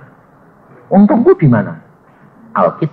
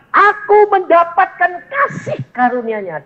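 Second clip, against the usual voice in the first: higher, lightly voiced apostle; a hint of Paul's joyful testimony; Paul speaking. Paul declares the grace he received, higher joyful voice. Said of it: "dia berkata".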